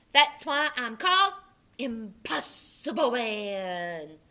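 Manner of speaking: loud, then a slow drawn-out hero name; superhero boast. Acted. A boy in costume tells the new girl his hero name, slow and grand. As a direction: announce yourself like a comic-book hero.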